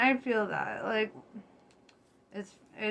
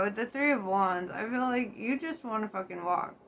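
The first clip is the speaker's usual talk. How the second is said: ordinary delivery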